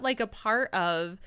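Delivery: normal talking voice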